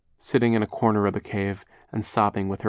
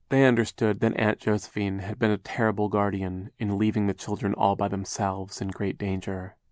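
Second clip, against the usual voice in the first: ordinary talk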